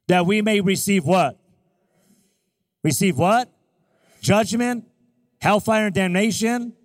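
punchy, forceful preacher's quiz; preaching call-and-response. Real, not acted. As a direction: throw each word out for the church to answer. Preacher quizzes congregation on verse.

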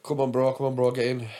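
light Italian accent on a retold quote; a hint of an Italian boatman. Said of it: "his best, uh, Italian accent".

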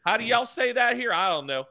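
high, slow hammed-up Southern yokel. Customer pranking drive-thru staff, high Southern accent. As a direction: stumble over the order in a Southern drawl. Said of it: "I'll do different voices at the window".

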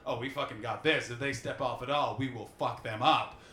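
low, confident threat; mock-tough low-voiced confidence; cocky natives. Acts out confident natives awaiting invaders.

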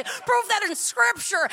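high, slow sneering challenge; mock the YouTube theologian's demand; snide online critic. Mocking a YouTube theologian demanding proof with a sneer. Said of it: "wanna destroy people and say".

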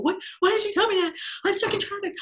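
high, fast panicked squeal; high-pitched frantic complaint. Retold or imitated. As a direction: squeak it out in a rush of stuck-in-traffic panic. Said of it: "I go".

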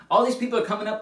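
normal talking voice

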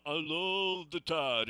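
slow monotone put-on caller voice; lightly imitated monotone Alabama fan; monotone caller impression. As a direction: drone it out slowly like the old caller. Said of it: "he had this monotone voice".

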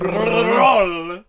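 "Roll" with a long trilled R, shouted high. Pretend. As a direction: trill the R in "Roll" hard and loud. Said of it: "I wasn't gonna roll my R's".

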